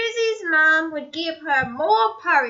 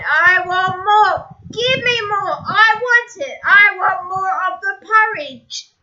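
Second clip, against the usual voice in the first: loud, higher greedy yell; 4 semitones higher, 5 dB louder than usual. Acted. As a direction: demand it loudly. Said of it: "again yelled".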